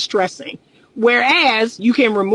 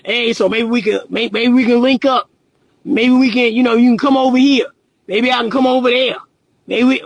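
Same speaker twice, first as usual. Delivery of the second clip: imitating phone guy. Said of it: "They talk like that over the phone".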